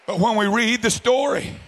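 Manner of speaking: loud, driving revival preacher's delivery; full-force preaching. Real, not acted. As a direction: preach it out hard and high to the congregation.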